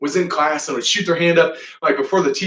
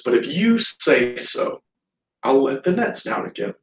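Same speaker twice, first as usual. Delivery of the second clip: reluctant agreement; 4 semitones lower than usual. Retold or imitated. Simon reluctantly gives in to Jesus.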